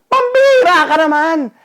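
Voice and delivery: husband scolding wife over the forgotten towel, furious; angry outburst. Retold or imitated. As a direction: blow up at her over the towel. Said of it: "Galit na galit ako".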